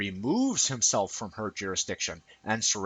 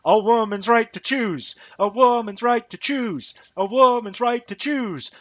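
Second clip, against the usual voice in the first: higher, louder, mocking slogan chant; mocking mantra; 12 semitones higher, 6 dB louder than usual. Mocking women who dodge arguments, a higher, louder parroted chant. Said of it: "just keep repeating".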